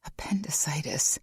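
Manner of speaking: breathy unvoiced whisper of dread; stunned whisper; horrified whisper. A narrator's reading. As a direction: let the word sink in. Horrified echo of the word.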